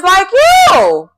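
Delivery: loud, high, drawn-out callout; mock accusing shout. Retells Ash's blunt accusation.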